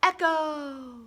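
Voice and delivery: playful echo effect on echo. Acted. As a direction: echo said high and ringing out. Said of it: "Did you hear the echo".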